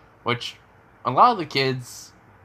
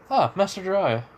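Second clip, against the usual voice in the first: lightly voiced, higher, breezy hello; casual Kakashi greeting; 8 semitones higher than usual. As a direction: toss off a lazy higher greeting.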